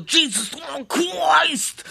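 shouted "Christ". Retold or imitated. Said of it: "immer so".

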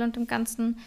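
ordinary talk

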